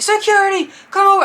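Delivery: high, loud imitated shout; mock urgent holler; calling for security. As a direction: summon a guard across the crowd. Imitates man calling security over.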